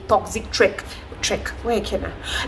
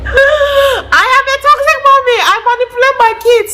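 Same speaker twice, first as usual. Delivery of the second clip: mock confession; 5 dB louder than usual. Real, not acted. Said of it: "Guess what I just realized".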